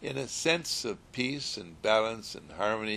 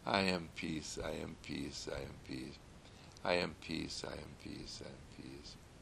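softer and lower each time until almost silent; fading to a hush; 6 semitones lower, 10 dB quieter than usual. Shown as a demonstration. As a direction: let each repeat die away into calm. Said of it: "say it gently and lovingly".

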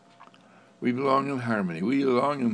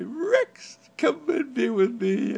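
high, loud laughing coax; coaxing a stubborn dog, laughing and high; laughing call to the dog; 14 semitones higher, 4 dB louder, slower than usual. Acted. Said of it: "laugh at him and say".

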